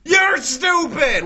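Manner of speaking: high, loud, slow yell; furious shout. Real, not acted. Gamer screams at the game after dying, furious. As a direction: blast it out in rage.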